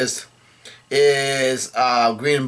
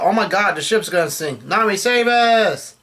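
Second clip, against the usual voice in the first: hammed-up cry for help, high-pitched; Luffy's mock yell; 6 semitones higher than usual. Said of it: "Luffy yells out".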